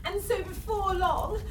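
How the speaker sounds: plain reading voice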